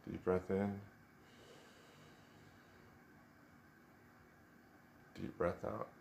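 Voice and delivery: soft, unhurried meditation voice with long pauses; guided meditation. Real, not acted. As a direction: gently guide them into stillness, leaving space after each instruction.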